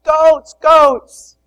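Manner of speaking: high, loud delighted cries of goats; loud high child's squeal at the goats; excited squeal. Retold or imitated. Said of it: "She's like".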